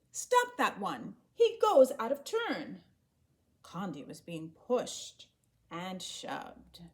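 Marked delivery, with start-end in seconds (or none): 0.1-2.8 loud, angry women shouting; angry yelling. A narrator's reading. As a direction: yell it out, furious at the queue-jumper. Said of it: "The women began yelling angrily".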